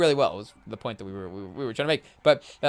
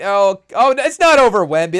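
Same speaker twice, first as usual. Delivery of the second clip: excited outburst; 3 semitones higher, 7 dB louder than usual. Real, not acted. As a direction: react like a fan seeing a poster. Live dunk reaction.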